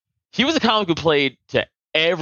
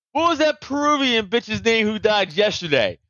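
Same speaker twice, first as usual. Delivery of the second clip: imitated stand-up comic; 6 semitones higher than usual. Acts out comic quizzing audience.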